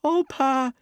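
put-on high, loud frightened child's cry; terrified little boy; shaking with fright. A narrator's reading. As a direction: cry out in terror to Pa. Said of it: "his voice shook with fright".

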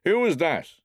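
bullying man demanding who is there; gruff challenge from father. Acted. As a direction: call out sharply into the dark. Drunk father challenges boy in dark.

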